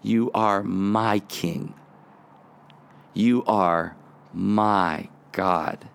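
low, slow and close, you are my king; intimate prayer. Retold or imitated. Preacher voicing David turning to God, low and slow. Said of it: "he brings it down and personalizes it".

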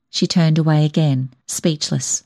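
usual speaking voice

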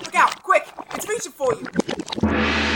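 loud, urgent warning cry; alarmed shout. Acted. Warning Doc again.

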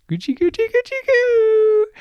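put-on very high, loud squeaky baby-talk coo; silly tickling coo. Wolf pouncing on the goblin, high squeaky tickle coo. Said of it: "with paws outstretched went".

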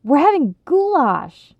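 put-on high, loud, thrilled kid; bursting with enthusiasm; enthusiastic announcement. A narrator's reading. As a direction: announce it with huge excitement. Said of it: "suffused with Ronnie's enthusiasm".

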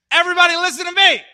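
high, fast, full shout; shouting to a crowd. Retold or imitated. Prophet on a box calling the town square, shouting. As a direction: yell it out as a street preacher. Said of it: "stands up on it and says".

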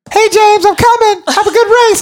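chirpy rival calling out as she passes; mock cheerful girl. Rival girl voiced passing him.

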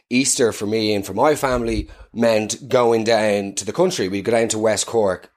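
ordinary talk, nothing marked

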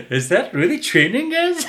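laughing the question out, pitched high; real laughing incredulity; laughing disbelief. Real, not acted. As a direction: ask while cracking up. Mocking trainer advice laughing.

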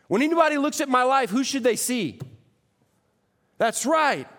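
full-force sermon delivery; fiery preaching. Acted. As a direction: preach it out to the whole room.

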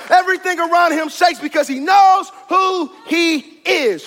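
full-force preaching. Real, not acted. Preacher urging the congregation out of tree jobs into the pond, full-force preaching. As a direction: build each repeated line to a ringing peak.

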